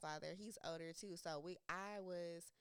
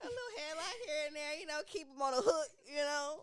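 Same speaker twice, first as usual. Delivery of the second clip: laughing; 6 semitones higher, 6 dB louder than usual. Real, not acted. Repeating friend's line laughing.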